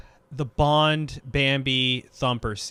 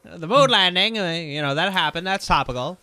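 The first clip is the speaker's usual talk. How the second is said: put-on lightly done mid-Atlantic accent, a touch higher and louder; 3 semitones higher, 5 dB louder than usual. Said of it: "doing a mid-Atlantic accent".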